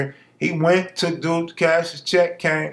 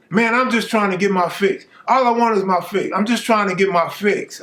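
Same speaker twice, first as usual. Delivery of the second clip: begging junkie; 6 semitones higher than usual. Retold or imitated.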